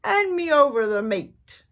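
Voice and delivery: loud, high greedy pirate bark; rowdy pirate captain; pirate demand. Acted. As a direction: demand it with your mouth half full. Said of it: "and said".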